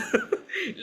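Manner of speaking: short high giggle; a quick embarrassed laugh. Real, not acted. Laughs at own past words.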